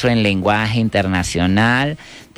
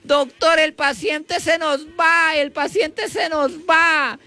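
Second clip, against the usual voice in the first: very high, drawn-out nurse's wail; panicked screaming; slower than usual. Acted. Frightened nurse tells surgeon the patient is going, hammed-up screaming. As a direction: cry out in hammed-up panic, stretching the endings. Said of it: "se asustó mucho y comenzó a gritar".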